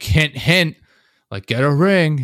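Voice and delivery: loud, high mock nudge; heavy-handed hinting. Grandmother hinting at an engagement, heavy-handed and loud. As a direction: imitate someone laying the hint on thick. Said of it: "heavy-handedly, she'll be like".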